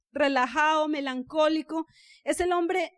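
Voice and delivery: ordinary talk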